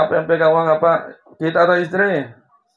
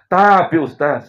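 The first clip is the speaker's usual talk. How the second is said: heavy-hearted; 4 semitones higher, 6 dB louder, slower than usual. Retold or imitated. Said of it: "dia berkata dengan berat".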